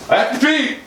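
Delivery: high-pitched, urgent exclamation; sudden high yelp of urgency; urgent outburst. Pretend. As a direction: blurt it out high, desperate for the toilet. Sudden outburst about needing toilet.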